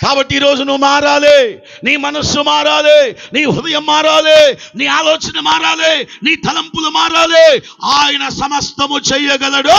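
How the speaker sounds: slow, high, shouted preaching; fiery revival preacher hammering every phrase; preaching at full force. Acted. Preaching climax, rising shouts.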